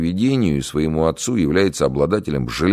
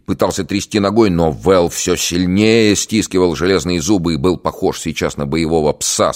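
ordinary delivery

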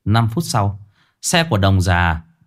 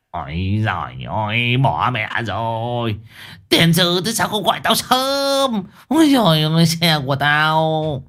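put-on dismayed old man; 5 semitones higher than usual. A narrator's reading. Learns his car was towed.

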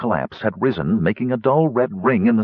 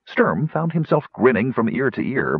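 ordinary delivery, nothing marked

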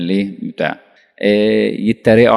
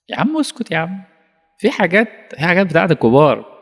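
raised, hushing voice telling someone off; a shushing elder, pitched up; mock hushing elder; 8 semitones higher than usual. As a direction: brush off a nosy kid.

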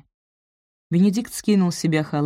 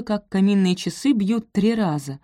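ordinary delivery, nothing marked

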